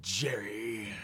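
low, quiet, intense sneer; Newman voice. Retold or imitated. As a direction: seethe the name with contempt. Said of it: "That's my very intense Newman".

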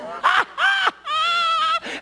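a big high whooping laugh of joy. Retold or imitated. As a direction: burst out laughing in rejoicing. Rejoicing laughter before the Lord.

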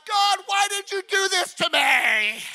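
high, loud wail of complaint; hammed-up shouted lament; acted yell at God. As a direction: cry out to heaven in mock despair. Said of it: "Or to yell at God".

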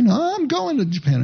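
high, emphatic quote of a stubborn friend; quoting Bob's reply. Retold or imitated. Pastor quoting Bob brushing off friends' worries, high and emphatic. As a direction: toss it off high and sure like Bob insisting. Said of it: "I can't say it in a Scottish accent".

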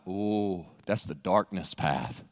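very low, slow, soft ominous voice; spooky guide. Acted. Guide warning the pilgrim about the dark path, ominous. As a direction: sound like a guide at a haunted trail.

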